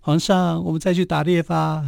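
a hint of a concubine sweet-talking the emperor; coaxing concubine. Teller mimics concubine coaxing the emperor to go hunting, mild playful plea. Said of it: "这女生可以跟你撒娇".

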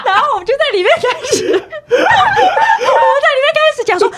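laughing. Real, not acted. Laughing while retelling group chat.

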